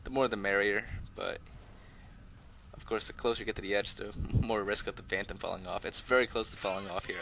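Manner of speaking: ordinary talk, nothing marked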